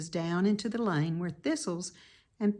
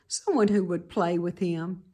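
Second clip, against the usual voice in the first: ordinary delivery, nothing marked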